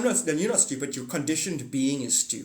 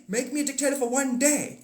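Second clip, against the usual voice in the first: exaggerated indignant retelling; mock outrage; 9 semitones higher than usual. Mocking Trump quote.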